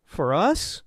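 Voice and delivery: falsely sweet, incredulous echo; syrupy coo. A narrator's reading. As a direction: coo it back, swooping upward. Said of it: "I cooed".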